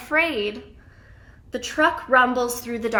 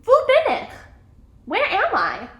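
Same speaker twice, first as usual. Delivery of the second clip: high-pitched questioning; voiced character's high question; puzzled asking; 10 semitones higher, 3 dB louder than usual. A narrator's reading. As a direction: ask it high, as if lost. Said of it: "She asks".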